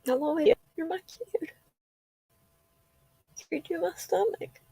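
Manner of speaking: holding back tears; crying. Real, not acted. Talking to daughter Lexi, tearful and choked up. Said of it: "I don't really cry like this".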